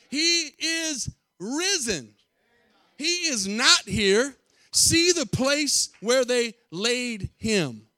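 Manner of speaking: slow, high, loud proclamation; angel proclaiming. Acted. The angel announcing to the women, slow, high and loud. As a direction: ring out the news like an angel. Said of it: "But he said to them".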